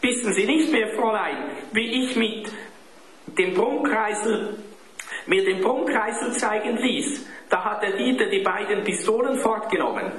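put-on high, urgent child's voice; high-pitched indignant appeal; indignant boy. A narrator's reading. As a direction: press your accusation as a wronged boy. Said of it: "schrie Klaus empört".

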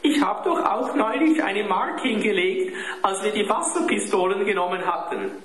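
put-on high, plaintive child's voice; high-pitched desperate confession; desperate boy. A narrator's reading. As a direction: plead your case like a tearful boy. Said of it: "schluchzte Klaus verzweifelt".

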